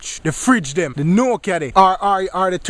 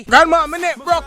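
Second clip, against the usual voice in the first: higher, louder whine; a kid crying out to grandma; hammed-up grandchild in pain; grandchild complaining loudly; 8 semitones higher, 4 dB louder than usual.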